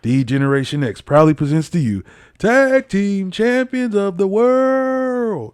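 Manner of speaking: booming, drawn-out ring intro; wrestling announcer. Retold or imitated. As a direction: announce it like a wrestling hype man. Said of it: "Remember Road Dogg?".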